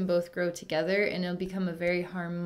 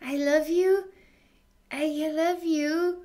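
lightly voiced loving heart, higher; a touch of a tender heart calling out, pitched higher; heart's voice; 8 semitones higher than usual. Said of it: "And heart's like".

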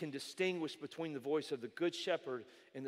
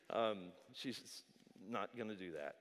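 ordinary talk

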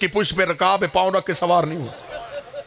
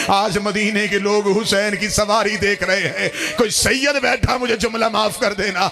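high, sustained, drawn-out lament; impassioned lament preaching; 10 semitones higher than usual. Acted. Majlis speaker telling mourners of Zahra's plea, impassioned lament. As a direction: preach it at full force, pitched high and stretched.

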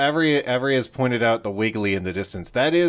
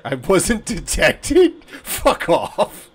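laughing disbelief; 4 semitones higher, 4 dB louder, much slower than usual. Real, not acted. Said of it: "laughs mid-line".

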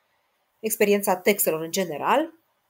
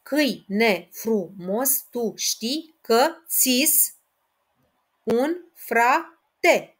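slow, syllable by syllable, rising a little louder; syllable counting; 2 semitones higher, 4 dB louder, much slower than usual. Shown as a demonstration. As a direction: count the line out slowly. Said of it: "Și ultimul, al patrulea".

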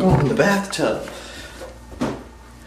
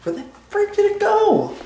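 high squeaky voice. Acted. High voice hunting fly.

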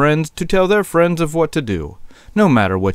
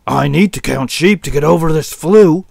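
loud, higher-pitched sheep whine; fed-up sick sheep voice; complaining sick sheep; 4 semitones higher, 5 dB louder than usual. Acted. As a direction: wail loudly about the flu. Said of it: "he mumbled".

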